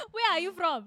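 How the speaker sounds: laughing mock African accent; high playful African accent. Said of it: "we said that in an African accent".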